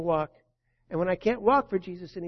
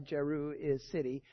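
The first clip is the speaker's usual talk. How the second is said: ordinary delivery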